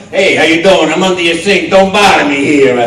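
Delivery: loud, mean tough-guy plumber; gruff Brooklyn plumber. Retold or imitated. Actor trying out a plumber voice, gruff and mean. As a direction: warn them off like a surly workman. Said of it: "gruff and hoarse and mean".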